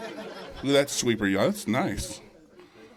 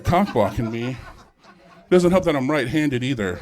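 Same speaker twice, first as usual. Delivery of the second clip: ordinary talk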